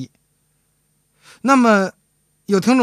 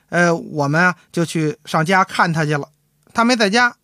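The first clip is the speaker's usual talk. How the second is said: ordinary talk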